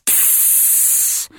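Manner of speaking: sharp hiss to stop the son; warning hiss. A narrator's reading. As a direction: hiss him into silence. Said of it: "Natasha Dolgorova hissed at her son".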